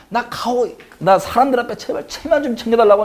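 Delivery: high-pitched mock begging; imitated desperate plea. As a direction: beg to save face, as a preacher imitating Saul. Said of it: "간절합니다".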